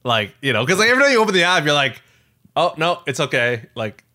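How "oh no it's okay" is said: normal talking voice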